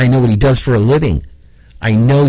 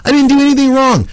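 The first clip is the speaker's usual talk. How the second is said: panicked neighbor; 13 semitones higher, 3 dB louder, faster than usual. Retold or imitated. Acted exchange with neighbor Eric.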